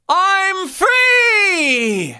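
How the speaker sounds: high, loud, cracking cry; gritty shout. Shown as a demonstration. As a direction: belt it with grit. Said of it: "a few times".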